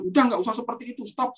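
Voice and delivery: high, fast mock hushing; hushing the child. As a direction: rush to shut down the tantrum. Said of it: "bukan sambil".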